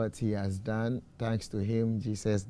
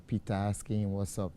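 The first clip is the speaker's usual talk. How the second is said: ordinary delivery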